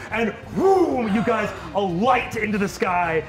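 usual speaking voice

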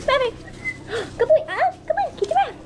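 high, loud coaxing call to a dog; squeaky praising a dog. Real, not acted. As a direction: sing out to the dog.

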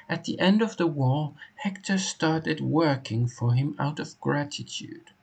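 high-pitched character reading; put-on high voice for a game informant. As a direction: voice the game's dialogue high, in character.

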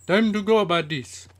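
loud, high rallying call; sudden yell. Real, not acted. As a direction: yell it out high to your buddies. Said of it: "I'm telling like that".

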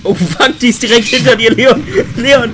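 panicked, rising urgent warning; being chased. Real, not acted. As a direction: yell a warning as it closes in. Monster right behind teammate.

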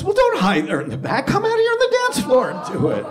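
rousing mock-DJ summons; DJ announcer. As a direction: voice leaping high on the call-out. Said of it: "And he goes".

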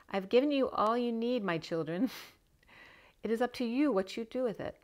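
a hint of a kindly divine father speaking to his children; gentle mock God voice. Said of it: "God in his pajamas saying".

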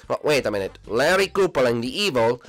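ordinary talk, nothing marked

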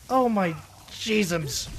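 ordinary talk

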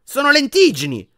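high, loud indignant shout; furious yell. A narrator's reading. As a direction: yell back, enraged. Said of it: "urlò Ron furibondo".

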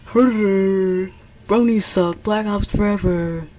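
loud, drawn-out parody of a hater; hammed-up jeering quote; mocking imitation. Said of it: "All you like is".

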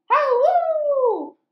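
long high loud howl; dog howl. Acted. Reading to kids, the dog howling loud and high. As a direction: howl like a hound.

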